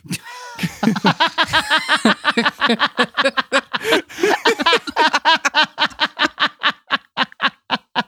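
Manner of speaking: long, high-pitched cackling laugh; helpless laughing fit. Real, not acted. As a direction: burst out laughing and keep going until it tapers. Cracks up at the name.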